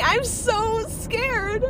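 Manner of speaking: high, drawn-out mock wail; retold tearful fear. Crying to a friend about growing up, imitated wail. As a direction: wail it slowly as if sobbing. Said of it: "I just kept saying".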